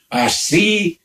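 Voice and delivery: high indignant countess retort; indignant cry. A narrator's reading. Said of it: "gridò".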